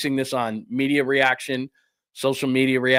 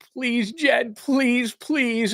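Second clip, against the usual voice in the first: high, pleading mock beg; begging; 11 semitones higher, much slower than usual. General manager begging the owner Jed to say yes, in a high pleading voice. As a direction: whine it up high like a desperate plea. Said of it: "You beg and you plead".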